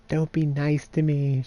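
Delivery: a lightly played girl pushing away comfort; lightly voiced girl Kaine.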